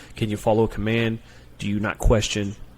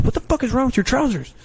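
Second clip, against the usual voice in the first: much higher, louder yelled question; drill yell; 14 semitones higher, 3 dB louder than usual. Retold or imitated. Recruiter yells at recruit over his pants, high and loud.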